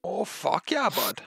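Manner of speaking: hammed-up Canadian hoser; light Canadian slang impression; mock Canadian. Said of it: "Canadian? Yeah, we're Canadian".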